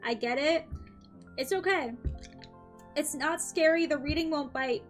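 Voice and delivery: ordinary delivery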